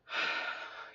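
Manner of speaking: soft tired exhale; weary sigh. Real, not acted. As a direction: breathe out in quiet resignation. Admitting she didn't feel it.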